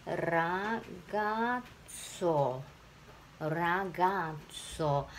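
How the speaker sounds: very slow, ragazzo split into its syllables. Shown as a demonstration. Teacher breaks ragazzo into syllables for the class, very slowly. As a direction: spell out ragazzo beat by beat, very slowly. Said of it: "音節は、".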